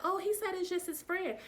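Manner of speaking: high, airy mock of a friend's excuse; mock girlfriend, high and breezy. Voicing the friend on the phone.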